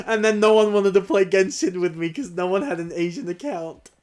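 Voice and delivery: high, loud, through laughter; laughing lament. Real, not acted. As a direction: laugh while complaining about being left out. Laughing about being left out.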